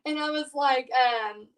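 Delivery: ordinary delivery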